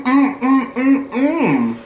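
talking between bites; eating while speaking; mouth full, chewing. Real, not acted. Seen 1: Both eat stuffed peppers; speaker unclear, mouth full mid-bite.

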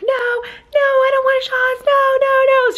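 very high, drawn-out mock wail; hammed-up panicked screaming; imitating screaming child. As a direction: squeal like a boy dreading his shots. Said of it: "screaming like Kyson was".

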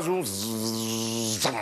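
low, soft droning zzz; buzzing insect. Retold or imitated. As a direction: buzz quietly like a trapped wasp.

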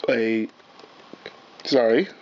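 ordinary delivery

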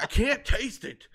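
complaining. Retold or imitated. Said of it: "I'm like".

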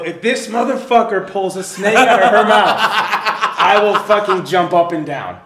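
loud, half-laughing outraged rant. Retold or imitated. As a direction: bark it out while cracking up. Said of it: "And I go".

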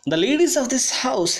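mild choked voice. A narrator's reading. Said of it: "in a voice choked with feeling".

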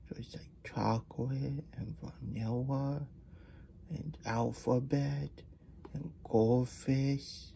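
slow, whispery wonder; soft, dreamy, lingering on each flavour; hushed awe. Real, not acted. Reader marvelling at a bathtub of ice cream, hushed awe.

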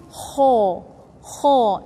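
ho, rough from the upper throat; rasping ho; strong kho. Shown as a demonstration. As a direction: model a strong ho. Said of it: "I pronounce it strong".

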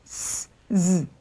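스 as a long hiss, then 즈 as a buzz; voiceless versus voiced S. Shown as a demonstration. Teacher contrasts the two S sounds, hiss then buzz. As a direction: contrast hissed 스 with buzzed 즈. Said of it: "S는 스 발음과 즈 발음 이렇게 두 개가".

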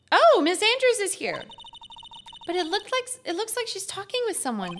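put-on high-pitched, girlish observation; note it in a high character voice; game heroine. Voices game heroine noticing someone.

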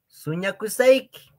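high, sweet gift offering; mock romantic offer; playful offer. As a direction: act out giving a flower.

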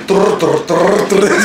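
rolled trrr like a frantic bass drum; imitated kick drum. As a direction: rattle out a nervous fast kick-drum roll. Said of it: "hizo así el pedal del bombo".

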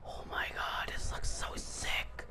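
breathless whispered amazement; awed whisper. Real, not acted. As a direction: whisper it in wonder. Awed by the game graphics.

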